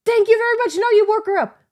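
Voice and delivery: high, loud sarcastic yell; retold yell. Tired mom yelling at the toddler, high, loud and sarcastic. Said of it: "um, I yelled".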